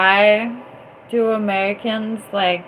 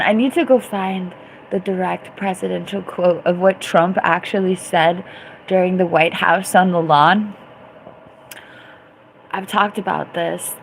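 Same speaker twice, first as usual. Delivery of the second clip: normal talking voice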